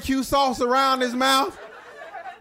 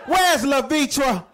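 yelling out the window; 3 semitones higher than usual. Retold or imitated. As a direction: holler names out a window at the kids. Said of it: "She always yelling at her out, out the window".

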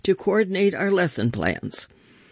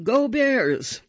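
calling out; 9 semitones higher than usual. Retold or imitated. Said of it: "So I called out".

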